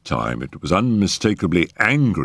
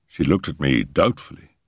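ordinary delivery, nothing marked

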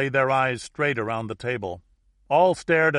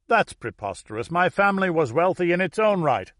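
indignant outburst; 7 semitones higher, 6 dB louder than usual. A narrator's reading. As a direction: protest rising in pitch. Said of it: "The audience exploded as one".

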